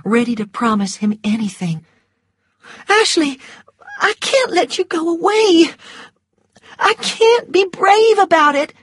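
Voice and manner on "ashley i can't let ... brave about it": high, tearful begging; desperate plea. A narrator's reading. Scarlett begging Ashley not to leave, high and tearful. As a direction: plead not to let him go.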